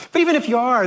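usual speaking voice

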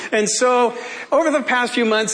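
ordinary talk

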